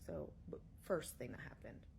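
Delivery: usual speaking voice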